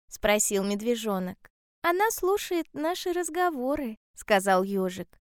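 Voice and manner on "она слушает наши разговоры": high little hedgehog voice. A narrator's reading. Hedgehog telling bear cub about fish, high hedgehog voice. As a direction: explain it high, as a storybook hedgehog.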